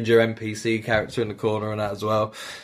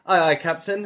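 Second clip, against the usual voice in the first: mock obedient engineer; 7 semitones higher than usual. Imitating obedient engineer NPC.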